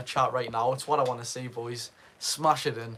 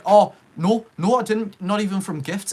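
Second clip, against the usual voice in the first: startled outburst; 7 semitones higher, 9 dB louder than usual. Real, not acted. Streamer startled, correcting himself loudly.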